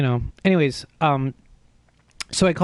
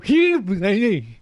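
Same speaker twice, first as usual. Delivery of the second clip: high, strangled yelp of panic; panicked squeal; 5 semitones higher than usual. Retold or imitated. Showing viewers his bug-panic noise, a high squeal. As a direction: let out the squeak he makes when a bug lands. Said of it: "that's the sound I make".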